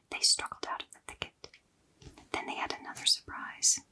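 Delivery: ordinary delivery, nothing marked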